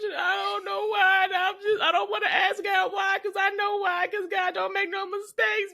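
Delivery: high, slow hammed-up grieving cry; over-the-top crying to Jesus; mock funeral wail. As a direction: wail it like a mourner at the casket.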